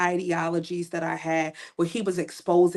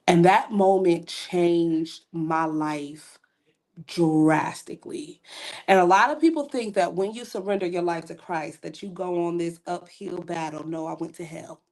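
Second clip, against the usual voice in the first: normal talking voice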